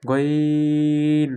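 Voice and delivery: গইন held long and slow; drawn-out Ghain. Shown as a demonstration. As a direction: stretch গইন out. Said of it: "আইনের গনের ভিতরে পার্থক্য".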